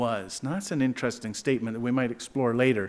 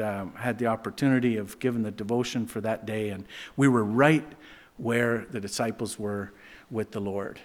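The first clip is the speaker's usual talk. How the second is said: normal talking voice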